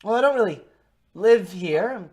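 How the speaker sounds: high, loud in-character reply; stranger in character. Acted. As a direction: pitch it up, evasive traveler.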